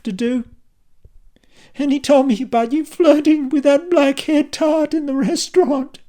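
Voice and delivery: high, loud, furious accusations; angry wife. A narrator's reading. Kylie accusing her husband of flirting, furious. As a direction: lash out at him.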